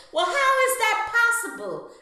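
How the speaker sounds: high, loud disbelieving question; incredulous outburst. Retold or imitated. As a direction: demand an explanation in shock. Outraged disbelief at duplicate name.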